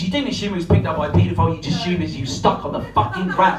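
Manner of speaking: usual speaking voice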